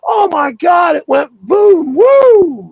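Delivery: high, loud, rising yell of boom; excited shout. Retold or imitated. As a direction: shout it like the thing just shot away. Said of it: "like that".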